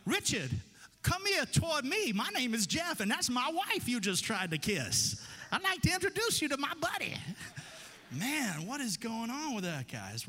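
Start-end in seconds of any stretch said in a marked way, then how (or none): 0.1-7.1 loud, high, mock threatening challenge; mock tough husband. Pastor pretending to confront the host over his wife, loud and mock tough. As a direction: square up to the host, half joking. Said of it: "I'd be like".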